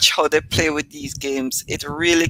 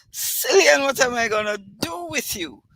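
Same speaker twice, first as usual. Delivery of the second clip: gasping laughter; 7 semitones higher than usual. Retold or imitated. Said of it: "red-faced and gasping".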